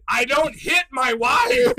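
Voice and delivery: loud, high protest that he doesn't hit his wife; blurted denial. Retold or imitated. To a staring Walmart family, a loud panicked denial. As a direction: cry it out defensively. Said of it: "And I went".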